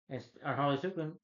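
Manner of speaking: usual speaking voice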